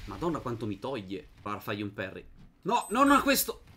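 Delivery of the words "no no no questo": rising yells of alarm; panicked shout. Real, not acted. Gamer shouts in panic at the brigand. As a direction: panic as the enemy strikes.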